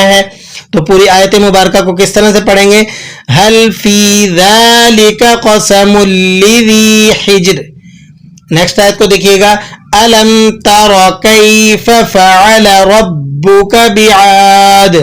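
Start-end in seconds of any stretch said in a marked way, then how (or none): 3.3-7.6 slow melodic tajweed recitation; Quran recitation. Shown as a demonstration. Recites full verse. 10.0-15.0 slow melodic tajweed recitation; Quran recitation. Shown as a demonstration. Recites verse.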